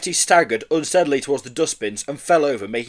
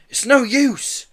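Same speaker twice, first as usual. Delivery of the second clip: dismayed; 11 semitones higher, 4 dB louder, much slower than usual. A narrator's reading. Door will not give way.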